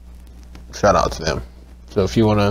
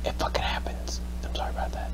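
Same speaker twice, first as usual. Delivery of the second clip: hushed, much softer whisper; 11 dB quieter than usual. Real, not acted. Gamer apologising to viewers, whispering. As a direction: drop to a soft whispered apology. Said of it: "Why are we whispering?".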